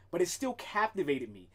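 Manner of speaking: ordinary talk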